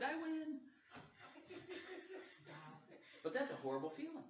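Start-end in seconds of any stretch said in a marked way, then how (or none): 0.0-0.6 triumphant. Retold or imitated. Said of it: "and I said". 0.9-3.2 breathy laugh. Real, not acted. Laughing after winning story.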